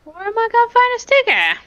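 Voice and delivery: very high-pitched squeaky whine; exaggerated high protest; whiny complaint. Mock complaint over stick.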